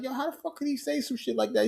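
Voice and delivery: high, soft squeal of disbelief; retold outrage. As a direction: replay your outrage in a small high voice. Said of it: "I was mad".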